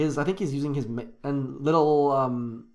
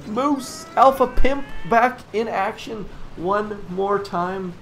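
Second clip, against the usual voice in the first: excited call; 7 semitones higher, 7 dB louder, slower than usual. Real, not acted.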